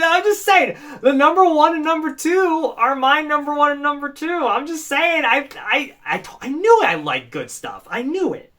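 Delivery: loud, high-pitched gloating; excited, boastful outburst; bragging. Real, not acted. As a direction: crow about being proved right. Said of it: "like, bragging right now".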